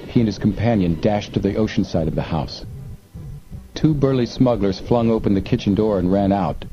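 usual speaking voice

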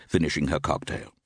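ordinary delivery

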